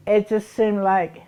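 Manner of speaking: ordinary talk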